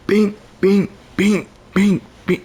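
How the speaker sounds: high, ringing "bing" repeated in bursts; imitated impact sounds. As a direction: punch out high bings, one after another. Imitates the trip hitting him.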